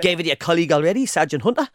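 quick Geordie line with a rising end; Geordie accent. Shown as a demonstration. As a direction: rattle it off Geordie style, lifting at the end. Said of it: "Do it".

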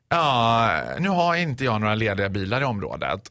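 low, flat dispatcher voice; a hint of a bored operator. Teller imitating the operator with no cars free, lightly voiced. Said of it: "Hon svarar så här".

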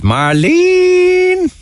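long, high-rising Cockney drawl on the name; Cockney accent. Retold or imitated. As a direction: imitate his drawn-out Cockney call, pitch climbing. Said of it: "much better Cockney accent than me".

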